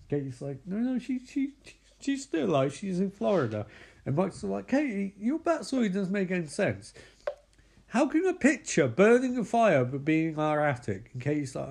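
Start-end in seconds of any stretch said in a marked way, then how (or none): 0.7-3.7 high-pitched stammering imitation; flustered denial. Katie insisting to Micah she's still alive, mock flustered. 4.7-11.1 high-pitched mock exasperation; exasperated. Micah questioning Katie's backstory, mock exasperated.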